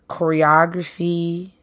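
usual speaking voice

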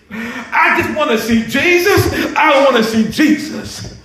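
building to a high, exultant cry; impassioned preaching. Real, not acted. Preacher promising victory over death, building to high, fervent preaching. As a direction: preach up to a triumphant climax.

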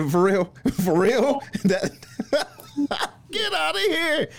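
high-pitched laughter through the words; can't stop laughing, half squealing it out; laughing in disbelief. Real, not acted. Host cracks up at her story.

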